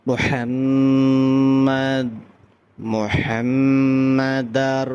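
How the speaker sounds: মুহাম্মাদার slow, the mm hummed long; level, slow recitation of মুহাম্মাদার with nasal hold; drawn-out ghunna. Shown as a demonstration. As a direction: stretch the doubled m in মুহাম্মাদ. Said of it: "মিমও একালিফ গুন্না হবে".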